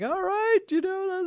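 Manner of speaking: lightly hammed-up chuckling host; amused pirate host. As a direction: imitate a host tickled by a kid. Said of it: "he laughed and he was like".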